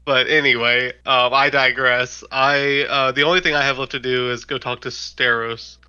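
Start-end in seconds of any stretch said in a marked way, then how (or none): none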